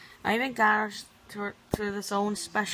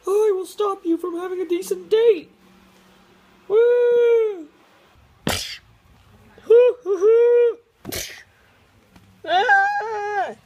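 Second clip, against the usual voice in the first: loud high puppet voice; 12 semitones higher, 7 dB louder, slower than usual. Pretend. Puppet attacks the couple.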